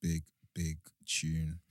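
ordinary delivery

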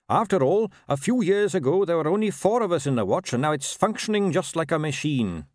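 high, earnest captain voice; keen young captain. Acted. Captain to constable about a new office system, keen and earnest. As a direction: reassure with upbeat official confidence.

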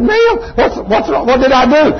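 high, stammering bewildered ask; flustered question. Retold or imitated. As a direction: fumble for words in surprise. Confused man questions hugging stranger.

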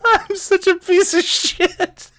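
slow, high, laughing self-reproach; guilty laughter. Real, not acted. Confessing guilt to listeners while still laughing. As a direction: laugh at yourself while calling yourself awful. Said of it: "I'm losing control here".

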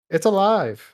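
high loud mad scientist cry. Retold or imitated. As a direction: shout it like Frankenstein's creator. Frankenstein reference, mad scientist.

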